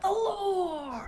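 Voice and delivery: high laughing cry; Matt Pat impression. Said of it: "I have to say it like Matt Pat".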